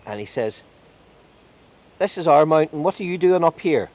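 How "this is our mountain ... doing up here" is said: loud, high-pitched territorial outburst; indignant challenge. A narrator's reading. Fairy king challenges a stranger on his mountain, indignant. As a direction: confront the intruder, voice raised and pitched up.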